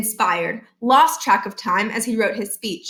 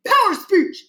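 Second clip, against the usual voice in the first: higher, raised gruff principal voice; 10 semitones higher, 3 dB louder than usual. Acted. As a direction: cut him off impatiently. Barkin insisting.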